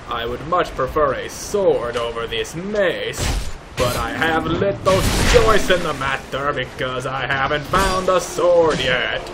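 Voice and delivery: ordinary delivery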